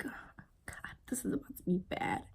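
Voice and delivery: breathless dread whispered to herself; a scared whisper under her breath. Retold or imitated. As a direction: whisper it, barely there, dreading what comes. Dreading her turn to shoot.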